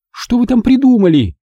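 she's nervous what he knows; anxious. A narrator's reading. Margo nervously pressing Engel.

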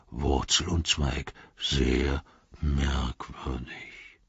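slow, deep voice of a patient tree giant, sometimes whispered; ancient Ent, slow and low. Acted. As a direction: muse over these strange little folk, taking all the time in the world. Treebeard speaks to the hobbits. (langsame, tiefe Stimme eines uralten Ents)

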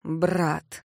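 low animal growl of one word; beastly growl. A narrator's reading. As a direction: say it like the beast would. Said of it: "совсем по-мехганорски произнёс".

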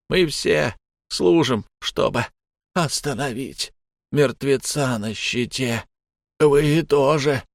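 bubbling through blood, dying; gurgling fanatic. Acted. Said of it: "фанатик пробулькал". (булькающий голос умирающего фанатика)

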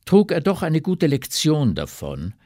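ordinary delivery

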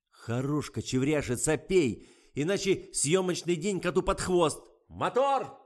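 loud, high impatient shouting; scold, then yell the command; exasperated order. A narrator's reading. Director loses patience, calls action.

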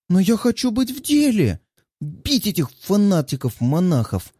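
high-pitched, eager indignant plea; heated protest. A narrator's reading. Wife begging husband to let her fight, high and heated. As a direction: protest hotly at being left out.

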